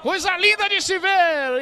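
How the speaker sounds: loud, high admiring shout; excited praise. Real, not acted. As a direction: marvel at the play out loud. Praising the goal. (elogio empolgado)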